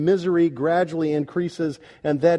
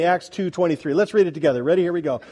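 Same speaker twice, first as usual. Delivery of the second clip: ordinary talk, nothing marked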